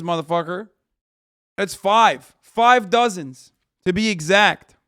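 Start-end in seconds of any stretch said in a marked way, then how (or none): 1.6-4.8 loud, exasperated. Real, not acted. Mocking the wrong count, loudly.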